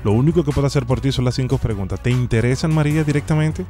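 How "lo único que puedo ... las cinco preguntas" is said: ordinary delivery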